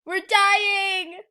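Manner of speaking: real whiny panic; desperate wail. Real, not acted. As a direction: plead loudly to be saved. Begging teammate for rescue.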